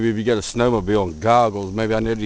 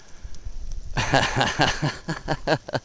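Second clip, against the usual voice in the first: short high soft laugh, falling; real laugh; 5 semitones higher, 5 dB quieter than usual. Real, not acted. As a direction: let a quiet amused laugh out. Amused at his deep footprints.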